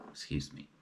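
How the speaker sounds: whispered excuse me. Real, not acted. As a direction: whisper the apology. Whispered apology after a slip.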